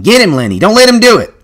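high, loud urgent yell; urgent shouted command. A narrator's reading. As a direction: holler to spur him into the fight.

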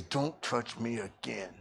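low, soft, slow and cold; quiet warning. Retold or imitated. Irritated passenger warning the preacher, low and quiet. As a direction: mutter it low as a last warning. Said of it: "He goes".

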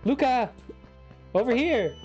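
high shouted call. Acted. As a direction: yell across the room to a friend. Calling out to teammate.

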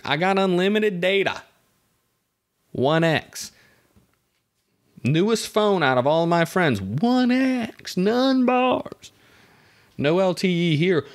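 high-pitched comic indignation; mock-exasperated rant. Customer complaining about no signal, mock exasperation. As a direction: rant upward, peaking on the punchlines.

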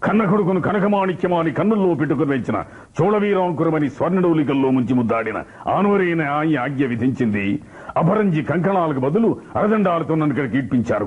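theatrical film-hero declamation; Jaggayya. Shown as a demonstration. Mimic voicing an actor's plea to a king, declamatory impression. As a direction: declaim ornate dialogue in rolling phrases, high opening sinking to the close. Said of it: "Jaggayya గారి voice వచ్చిందన్నాను".

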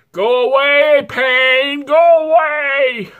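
high, loud cry at the pain, slowing on the last words; pained plea. Real, not acted. Vlogger crying out high and loud for the spice pain to go. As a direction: beg the burn to stop.

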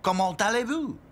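mock French wizard greeting; lightly put-on French accent. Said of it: "his French accent".

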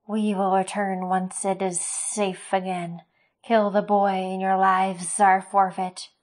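slow, loud, cold decree; menacing creature declaration. Acted. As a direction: pronounce the threat slowly. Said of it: "the larger Razak declared".

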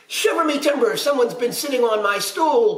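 high, outraged pirate roar; storybook roar, pitched high. A narrator's reading. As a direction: roar like an affronted pirate papa. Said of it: "Papa roared".

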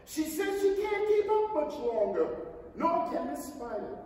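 anxious centipede. Acted. Centipede calling up worried.

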